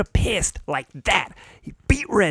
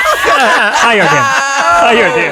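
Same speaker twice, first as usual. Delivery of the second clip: loud, higher, laughing it out; laughing shout; 7 semitones higher, 10 dB louder, much slower than usual. Real, not acted. Telling the story's end, shouting and laughing. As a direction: shout the punchline through laughter.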